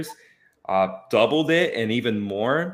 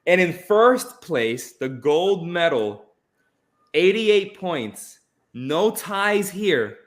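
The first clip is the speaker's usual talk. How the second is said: big, slow first-place reveal, pitched up; awards announcer; 5 semitones higher, 4 dB louder, much slower than usual. Acted. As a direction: announce the champion slowly, pausing before the name.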